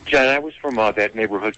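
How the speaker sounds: ordinary talk, nothing marked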